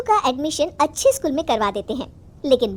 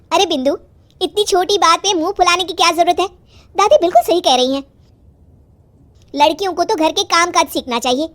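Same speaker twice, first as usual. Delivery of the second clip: higher, louder mother's voice; scolding mother; 5 semitones higher, 6 dB louder than usual. Acted. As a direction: chide the girl, raised pitch and volume. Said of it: "तभी उसकी माँ कहती है". (डाँटती माँ की ऊँची आवाज़)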